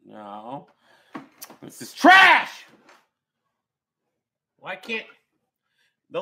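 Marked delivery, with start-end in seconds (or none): 1.6-2.6 slow, loud disgusted yell; angry shout. Real, not acted. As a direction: spit it out in disgust. Reacting to his bad stats.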